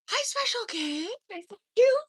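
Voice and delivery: high cooing hello; mock sweet greeting. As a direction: coo at it like a new friend. Greeting the fly killer.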